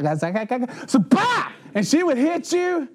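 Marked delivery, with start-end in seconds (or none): 0.0-0.9 hammed-up windup grunts "gak, gak, gak, gak"; mock a teacher winding up the paddle swing; teacher warming up. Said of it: "she'd be like". 0.9-1.5 smack the word out like a paddle blow; paddle smack sound. Retold or imitated. Paddle hit sound effect.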